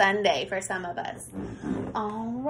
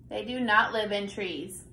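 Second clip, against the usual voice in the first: normal talking voice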